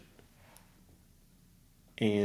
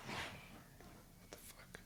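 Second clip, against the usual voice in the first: slow, breathless whisper of disbelief; stunned whisper; 25 dB quieter, much slower than usual. Retold or imitated. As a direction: barely breathe it, slowly. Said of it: "And so he's like".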